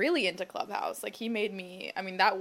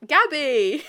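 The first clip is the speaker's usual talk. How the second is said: put-on high, loud British-accented greeting; imitated British accent; 6 semitones higher, 13 dB louder than usual. Woman mimics British former date's greeting, loud British accent. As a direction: call out brightly in a mock British accent. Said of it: "I just hear his, like, accent be like".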